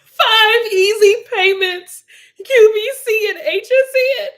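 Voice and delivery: high, loud, slow squeal of laughter; crying with laughter. Real, not acted. Teasing Andrea about TV shopping, crying with laughter. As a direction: wail it out, helpless with laughter. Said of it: "Oh, I'm crying. I'm laughing so hard".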